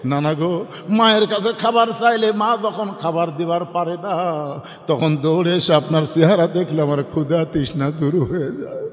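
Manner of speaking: low, melodic lament; acted tearful pleading. Hussein crying to his grandfather, tearful chanted plea. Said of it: "মুখ লাগায় কান্দে আর বলে".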